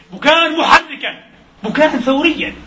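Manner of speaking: high, slow, stirring cry; rousing. Real, not acted. Preacher rouses the congregation to act. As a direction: build to a rallying peak. Rousing call for revolutionary weeping.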